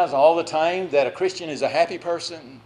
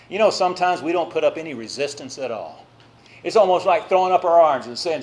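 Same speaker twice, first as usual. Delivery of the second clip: ordinary talk, nothing marked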